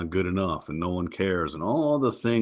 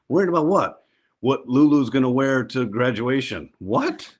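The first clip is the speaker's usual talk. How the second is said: high incredulous what, rising to a squeak; mock disbelief; 7 semitones higher, 5 dB louder than usual. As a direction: act baffled that anyone would worry this early. Husband mocks wife's worry.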